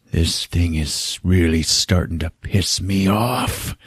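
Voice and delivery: slow, soft, low growing irritation; low seething annoyance. Retold or imitated. Stone fed up with the killer, slow quiet seething. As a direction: play quietly fed up, drawing it out.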